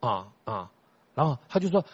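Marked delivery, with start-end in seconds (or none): 0.0-0.7 noncommittal grunts. Retold or imitated. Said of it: "我就说".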